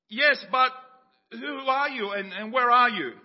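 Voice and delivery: loud, high, bewildered shout. Acted. Jack shouting up at the unseen voice, bewildered. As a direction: call out asking who's there.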